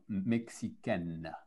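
slow, careful mexicaine; teacher modelling "mexicaine" slowly; slow model word. Shown as a demonstration. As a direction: draw out each sound of "mexicaine" for the class. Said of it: "mexicain, masculin".

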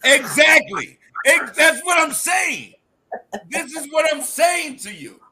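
loud laughing agreement; excited shout. Real, not acted.

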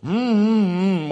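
closed-mouth mm hums; mute mumbling. Retold or imitated. Zechariah mumbling to his wife without words. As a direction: hum like a man who can't speak. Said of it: "mumbling again".

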